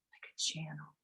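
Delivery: breathy meditation whisper. Acted. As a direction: whisper it close and soft.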